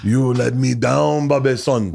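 slow, put-on accent of a disappointed father; Donnell. Host imitating Donnell scolding his pretend son, hammed-up accent. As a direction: stretch it out as a mock father's reproach. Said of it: "very inappropriate accents of each other's race".

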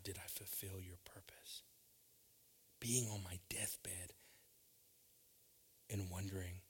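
preacher dropping to a hush before the congregation; hushed preaching. Acted. Preaching in a hushed near whisper.

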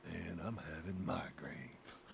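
a lightly voiced mellow kid complaining; Billy's mellow voice. Retelling Billy's excuse to skip football, slow and low. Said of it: "he had that deep voice".

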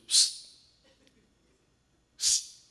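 short voiceless attention-getting hiss; frog's psst. Acted. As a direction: sneak a little "psst" to someone nearby. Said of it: "Frog says".

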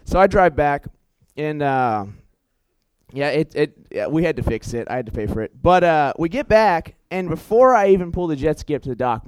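usual speaking voice

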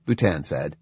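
plain reading voice

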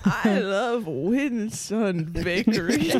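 slow, stretched folksy drawl; hammed-up old-timer. Joins in the old man bit.

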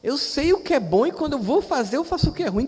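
voz de Paulo levemente encenada, bem mais aguda e lenta; dê uma leve voz de apóstolo confessando; Paulo citado. Retold or imitated. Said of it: "Paulo diz". (citação de Paulo com voz aguda e arrastada)

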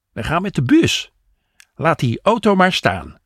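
high, brisk-spirited woman's voice; high-pitched, decisive suggestion; Jopie deciding. A narrator's reading.

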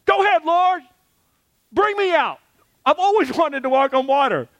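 high, eager, quickening voice; eager Peter. Retold or imitated. Peter asks the Lord to call him out, high and eager. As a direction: beg to be let out onto the water, thrilled. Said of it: "Peter's like".

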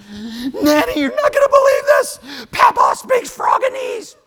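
loud, very high breathless squeal; excited child shouting. Retold or imitated. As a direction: imitate a little boy bursting with news. Grandson runs in with big news.

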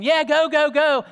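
high, loud mock cheering; hammed-up yelling of bystanders urging a driver on; cheering crowd. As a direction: egg him on like a rowdy onlooker. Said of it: "Those same people going".

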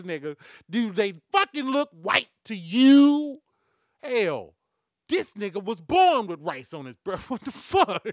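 loud, high, incredulous rant; exasperated outburst. Real, not acted. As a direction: drag out the stressed words and yell in disbelief.

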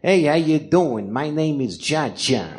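put-on slow, drawn-out New York wiseguy accent; thick New York mobster accent, unhurried; Cha Cha impression. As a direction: drawl it like a New York boxing manager. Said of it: "He literally talked like this".

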